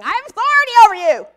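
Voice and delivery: high, loud, fast yell of command; retold scream. Preacher retelling screaming at a wasp, loud high scream. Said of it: "I just kept screaming".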